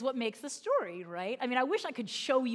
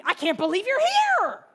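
loud, high, drawn-out last word; excited shout building to a long high HERE; thrilled kid; 10 semitones higher, 10 dB louder than usual. Retold or imitated. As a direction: imitate a child yelling in delighted disbelief. Imitates child thrilled at visit.

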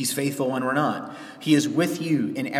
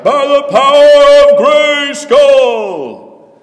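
slow, much higher and much louder battle cry; bombastic epic shout; 12 semitones higher, 15 dB louder, much slower than usual. Retold or imitated. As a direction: bellow it like a cartoon hero raising a sword. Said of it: "everything in epic poetry sounds like".

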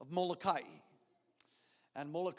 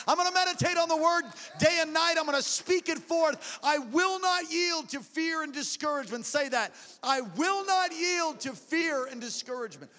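declarations climbing higher and louder to a roar; preaching building to a shout; 6 semitones higher, 10 dB louder than usual. Acted. Pastor rousing the church to refuse fear, shouting. Preaching rising to full force.

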